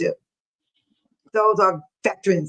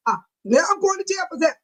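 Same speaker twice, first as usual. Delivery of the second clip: fast, higher mock panic at going to jail; mock jailed protester; 7 semitones higher, faster than usual. Imagining her arrest, she acts out a fast mock panic. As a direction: play yourself suddenly hauled off to jail. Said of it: "What if I go".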